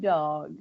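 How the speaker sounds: "dog." in her own accent; lisped word. Shown as a demonstration. Guest showing which words reveal her lisp. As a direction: say "dog." as she always would. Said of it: "when you say things like".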